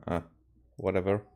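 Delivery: ordinary talk, nothing marked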